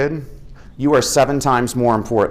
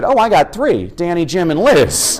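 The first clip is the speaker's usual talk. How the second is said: high, cheeky mom voice; a mother with a sly joke; playful mock mom quip; imitating his mom; 6 semitones higher than usual. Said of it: "she always said".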